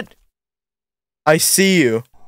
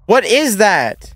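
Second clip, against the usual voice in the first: slow, higher, alarmed questions; startled; 4 semitones higher, much slower than usual. Real, not acted. Player startled by something in the dark, slow and alarmed. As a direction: drag it out, spooked.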